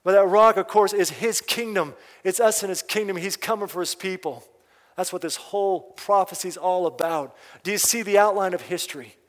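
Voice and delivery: loud, high, ringing sermon delivery; full-force pulpit preaching; fiery preaching. Acted. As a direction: preach it out to the whole hall.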